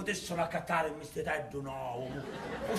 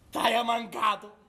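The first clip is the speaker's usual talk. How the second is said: high manic joy; 8 semitones higher, 6 dB louder than usual. Acted. Talks lovingly to his casket.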